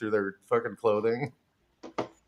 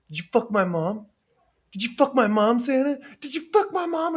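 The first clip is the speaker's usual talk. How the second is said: building from a higher question to a far higher frantic yelp; Charlie losing it; 10 semitones higher than usual. Retold or imitated. Charlie demanding answers from Santa, mock frantic building. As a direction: escalate each repeat, mock unhinged. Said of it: "because Charlie goes".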